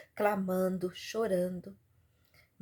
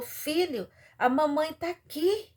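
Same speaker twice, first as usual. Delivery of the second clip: put-on high-pitched motherly call to her child; 8 semitones higher than usual. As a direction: call to your son, voice lifted high. Mother calls to her son. (chamado de mãe, em tom agudo)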